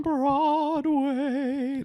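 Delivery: high, slow drawn-out line; dramatic announcer. Acted. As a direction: stretch it out theatrically. Exaggerated dramatic correction.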